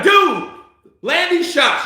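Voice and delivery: loud, high, frustrated shouting at the screen; yelling at the fighter. Real, not acted. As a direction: bark it at the TV like a fan.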